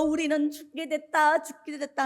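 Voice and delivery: put-on weeping lament; mock crying. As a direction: wail in imitated despair, then let it drop. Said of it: "울면서".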